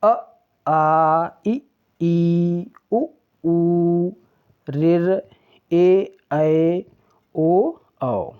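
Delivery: short अ clipped, long आ held out; teacher sounding out each vowel from अ to औ; vowel recitation. Shown as a demonstration. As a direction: recite अ, आ, इ, ई one at a time. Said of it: "तो स्वर वर्ण जान लेते हैं".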